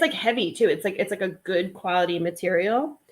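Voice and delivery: ordinary talk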